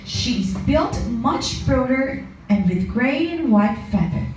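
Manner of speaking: plain reading voice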